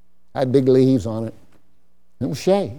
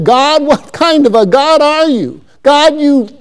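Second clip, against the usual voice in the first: angry Jonah; 6 semitones higher, 4 dB louder than usual. Acted. Preacher voicing Jonah's anger at God, raised and indignant. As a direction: whine at God like a furious prophet. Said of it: "Exceedingly angry, he said".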